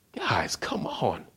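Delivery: slow, drawn-out whispered plea; acted breathy reproach to sleepy friends; exasperated whisper. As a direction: whisper it wearily, imitating a let-down leader. Jesus finds the disciples asleep.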